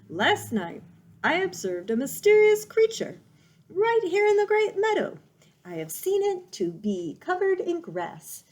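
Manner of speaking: high, loud owl telling a spooky tale. Acted. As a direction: build up to a hushed monster reveal. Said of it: "Swooper the owl hooted".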